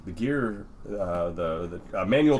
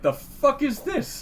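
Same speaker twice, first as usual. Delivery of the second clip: higher, slow, incredulous bartender voice; mock disbelief; 14 semitones higher, slower than usual. Imitating bartenders who can't believe the name on the card, pitched-up mock disbelief. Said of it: "they're like".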